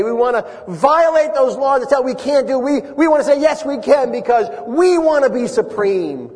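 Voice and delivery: high-pitched, driving sermon delivery; impassioned preaching. Real, not acted. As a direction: preach it hard, hammering each repeated phrase. Preaching at rising force.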